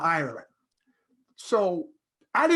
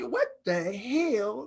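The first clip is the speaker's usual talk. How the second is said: bewildered; 6 semitones higher, much slower than usual. Retold or imitated. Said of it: "I was like".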